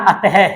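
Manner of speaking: "अतः" ending in an audible "heh"; visarga pronunciation. Shown as a demonstration. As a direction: sound out the final h in "अतः". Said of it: "ध्वनि किसकी आएगी". ("अतः" के अंत में "ह" की ध्वनि)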